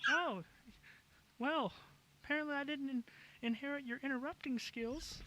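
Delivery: put-on high-pitched little boy voice; kid voice. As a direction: play an eleven-year-old son, pitched high. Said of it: "I'm in character as a fucking kid".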